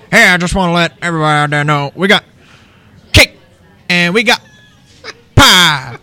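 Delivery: over-the-top Cajun announcer; hyped-up pastry hawker. Retold or imitated. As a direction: sell the cake and pie like a carnival barker. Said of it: "he loves whenever I do impressions of him".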